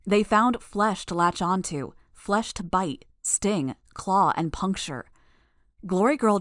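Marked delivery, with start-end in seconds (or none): none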